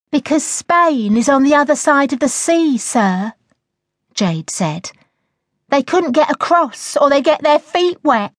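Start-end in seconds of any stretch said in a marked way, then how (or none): 0.1-3.3 high-pitched schoolgirl answering back; high, cheeky classroom answer; cheeky pupil. A narrator's reading. 5.7-8.3 high-pitched schoolgirl answering back; high, cheeky classroom answer; cheeky pupil. A narrator's reading.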